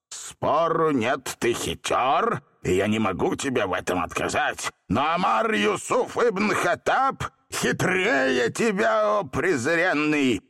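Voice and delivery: slow gloating, climbing to a high proclamation; triumphant. A narrator's reading. Genie boasting he outwits the boy, triumphant. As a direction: build to a drawn-out boast. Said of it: "торжествующе произнёс".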